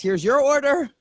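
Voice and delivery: playful put-on delivery voice; delivery driver. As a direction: hand over the bag like a cheery Uber Eats driver. Playing delivery driver again.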